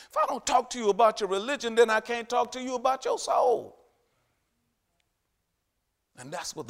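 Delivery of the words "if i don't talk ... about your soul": high, much louder fiery preaching; preaching at full force. Acted. Preacher thundering at the congregation at full force. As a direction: preach it with all your fire.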